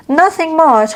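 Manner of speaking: "Nothing much" pitched high and bright; cheerful offhand answer; lively reply. Shown as a demonstration. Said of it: "demo reply".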